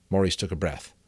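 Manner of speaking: usual speaking voice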